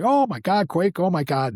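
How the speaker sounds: high, loud excited outburst; astonished recognition. Retold or imitated. Drummer suddenly recognizes his old colleague, astonished recognition. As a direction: burst out in amazement, starting high and easing slightly.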